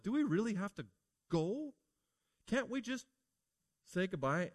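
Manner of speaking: lightly voiced pleading wife. Wife begging Lot to let her say goodbye, pleading.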